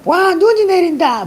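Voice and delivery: 높은 목소리로 신나게 외치는 아이 흉내; 눈 온다고 들뜬 아이처럼 높게 외쳐. Retold or imitated. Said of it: "뭐 이렇게 했었어". (눈을 보고 신난 동생 흉내, 높고 들뜬 외침)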